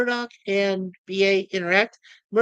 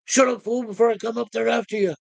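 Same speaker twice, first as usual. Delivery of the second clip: raised, quick threatening quote; 4 semitones higher than usual. Retold or imitated. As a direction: imitate a tough guy's threat. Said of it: "B A says to Murdoch".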